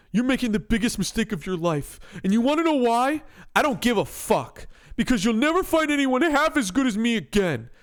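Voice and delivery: loud, heated scolding; angry. Acted. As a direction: argue back hard. Acted breakup quarrel.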